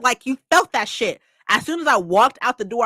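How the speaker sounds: usual speaking voice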